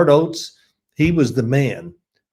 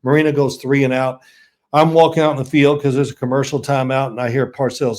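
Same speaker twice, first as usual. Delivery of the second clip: normal talking voice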